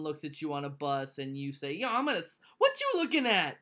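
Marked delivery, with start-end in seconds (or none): 1.7-3.6 high, loud mock street challenge; tough guy voice. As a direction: bark it like a bus tough. Mocking tough guy on bus.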